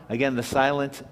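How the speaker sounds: ordinary delivery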